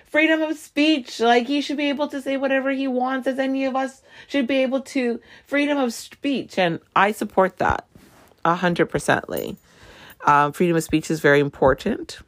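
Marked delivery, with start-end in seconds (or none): 0.0-6.6 much higher, whiny mock outrage; mocking upset supporters. Mocking people upset over Trump's Twitter ban in a high whine. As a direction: parody them as squeaky and indignant. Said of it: "They're just like".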